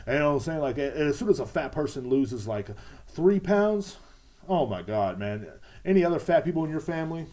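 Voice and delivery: usual speaking voice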